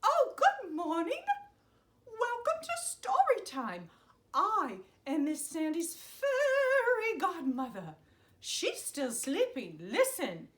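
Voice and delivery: high, cheerful fairy godmother; a chirpy magical helper greeting kids. Acted.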